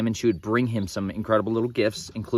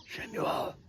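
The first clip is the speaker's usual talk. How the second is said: whispered echo; 11 dB quieter than usual. Shown as a demonstration. Name echoed in a whisper.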